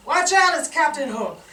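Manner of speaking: loud, high hammed-up commercial voice; ad announcer. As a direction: sell it like a cheesy ad. Mock ad announcer pitch.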